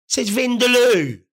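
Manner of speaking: Vindaloo said slow and loud, falling; emphatic outrage. Real, not acted. Indignant at the tiny packet writing, Vindaloo hammered slowly. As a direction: lean hard on Vindaloo, slowing right down.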